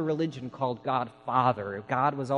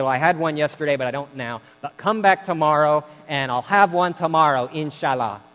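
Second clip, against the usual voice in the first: shopkeeper; 3 semitones higher, 8 dB louder than usual. Retold or imitated. Shopkeeper promising teapot tomorrow.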